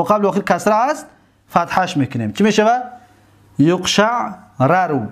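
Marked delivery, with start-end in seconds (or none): 3.6-5.0 drawn-out yuqsha'arru, each syllable spelled out; teacher's slow model of یقشعررو; slow drilled form. Shown as a demonstration.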